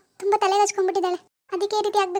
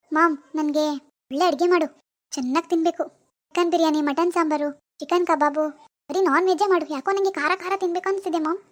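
put-on lower, hushed young woman lost in a delusion; disturbed daughter; 3 semitones lower than usual. Daughter Swathi's role voice.

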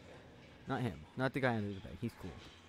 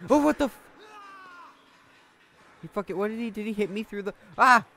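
loud, high startled yell; startled shout; 11 semitones higher, 14 dB louder than usual. Real, not acted. Player hit by an enemy, loud high startled yell. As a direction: yelp as if struck.

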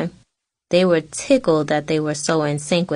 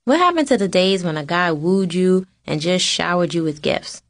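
ordinary delivery, nothing marked

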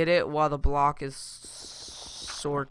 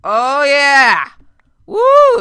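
high loud victory cheer; loud, high whooping; triumphant whoop; 11 semitones higher, 17 dB louder, much slower than usual. Real, not acted. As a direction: celebrate the trick working. Gravel trick works.

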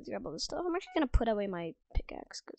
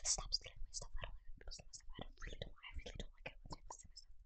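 very soft whispered complaint; 13 dB quieter, faster than usual. Real, not acted. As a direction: whisper it under your breath. Whispered complaint about stolen stuff.

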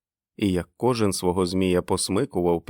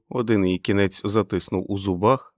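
ordinary delivery, nothing marked

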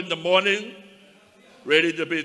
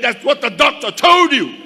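defiant retort; 8 semitones higher, 10 dB louder than usual. Retold or imitated.

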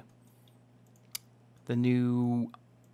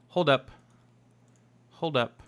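usual speaking voice

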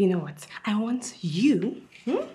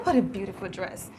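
usual speaking voice

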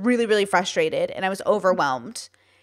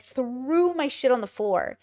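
ordinary talk, nothing marked

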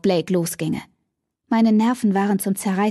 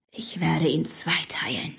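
soft breathy threat under the breath; menacing whisper; 4 semitones lower, 7 dB quieter than usual. Acted. Damon whispering a threat to cut Blake in two. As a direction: whisper it like a deadly promise.

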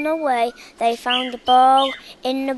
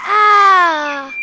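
scream; 3 semitones higher, 6 dB louder than usual. A narrator's reading. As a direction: shriek in fright at the cub. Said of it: "Mickey turned around and screamed".

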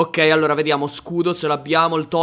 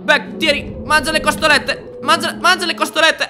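frantic urging; 8 semitones higher than usual. Real, not acted. Urging dragon to eat, attacked.

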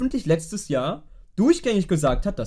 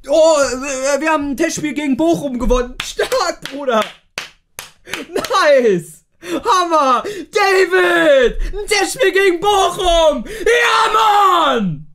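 sarcastic cheering; 6 semitones higher, slower than usual. Acted. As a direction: fake joy over a meaningless win. Sarcastic praise of a friendly win.

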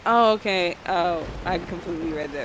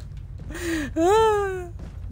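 high squealing laugh; 12 semitones higher than usual. Real, not acted.

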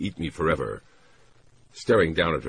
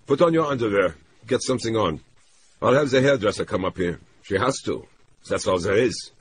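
raised, pressing voice of an urgent man; higher-pitched, insistent male character; man taking charge; 6 semitones higher, 5 dB louder than usual. Acted. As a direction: give firm orders, pitched up and urgent. Narrator voices man giving orders.

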